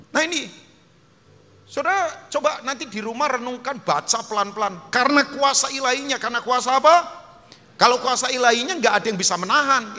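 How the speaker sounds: loud, driving sermon voice; full-force pulpit preaching; fiery preaching. Acted. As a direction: preach it hard to the whole church.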